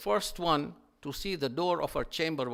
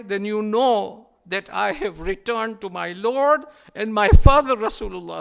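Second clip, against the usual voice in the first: rising lament; 5 semitones higher, 12 dB louder than usual. Acted. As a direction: voice swells to a loud, pleading cry.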